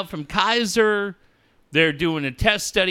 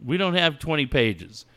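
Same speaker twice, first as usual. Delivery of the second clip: imitated caller; 6 semitones lower, 5 dB quieter than usual. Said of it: "She's like".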